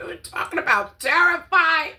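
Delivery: loud high cry; incredulous. Real, not acted. Asking viewers if she was scared, loud and high. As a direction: cry it out in disbelief. Exclaiming fear.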